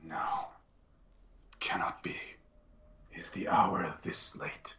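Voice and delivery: hushed, breath-only disbelief; stunned whisper. Acted. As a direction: whisper it to yourself, slowing at the end. Interrogator unsettled, muttering to himself.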